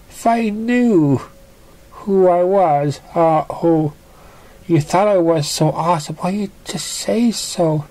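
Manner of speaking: high, hesitant apologetic pony voice; shy pony Piggy. Acted. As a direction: fumble the apology, a little flustered.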